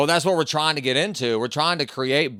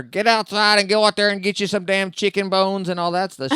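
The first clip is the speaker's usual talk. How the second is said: imitating grandma in a much higher Southern accent; Southern grandmother accent; 7 semitones higher, 4 dB louder than usual. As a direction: pitch up into an old Southern grandma giving orders. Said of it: "my grandmother talked like that".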